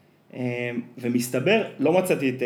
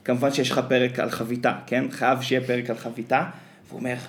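ordinary talk, nothing marked

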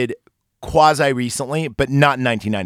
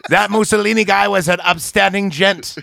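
higher vintage ad pitchman; chipper 1950s ad; 6 semitones higher than usual. Pretend. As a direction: deliver it like a proud wartime ad. Mock ad announcer.

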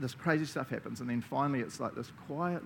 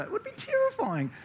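dramatic awe. Acted. Preacher imagines terrifying mountain.

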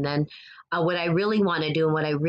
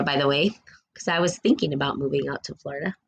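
ordinary talk, nothing marked

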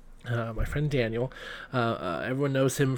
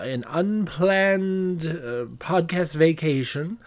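ordinary talk, nothing marked

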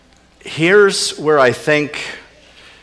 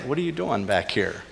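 much softer, gentle question; gentle asking; 8 dB quieter than usual. Pretend. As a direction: ask softly, without blame. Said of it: "You know, he just like".

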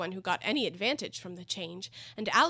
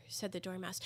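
ordinary delivery

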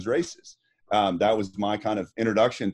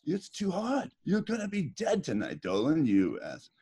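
Urbanchek impression; 2 semitones higher, 6 dB quieter than usual. Swimmer imitating his coach scolding him after the race. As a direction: play the old coach chewing him out. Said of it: "you're doing a dead on Urbanchek".

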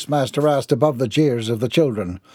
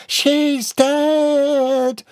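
put-on high, stretched child's cry; screaming little girl; 13 semitones higher than usual. A narrator's reading. A little girl sees the fallen nun, screaming. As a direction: squeal it in horror. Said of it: "screamed a little girl with pigtails".

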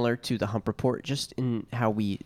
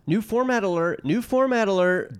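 announcer; 7 semitones higher, 4 dB louder than usual. Acted. Announcing new segment.

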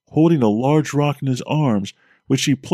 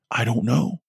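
mouthed; 3 dB quieter than usual. Acted.

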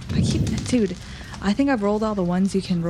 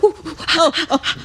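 pained yelps; 8 semitones higher, 4 dB louder than usual. Retold or imitated. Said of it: "And I'm like".

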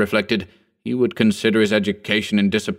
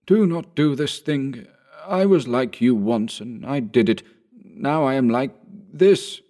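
mild cultivated voice; 5 semitones higher than usual. A narrator's reading. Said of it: "in a mild and cultivated voice said".

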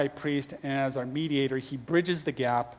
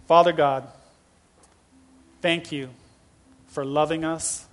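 prayer. Acted. Closing prayer.

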